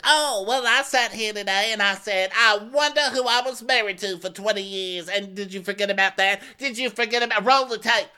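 high, loud hammed-up anger, nearly shouting; mock angry Crystal. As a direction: overact the fury to prove she's angry. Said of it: "Am I doing it right? Does anybody hear it?".

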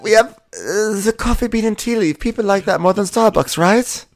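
high-pitched put-on townsperson; high mock-character voice; skit character voice. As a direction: play a rival townsperson, voice way up. Acted rival in coffee skit.

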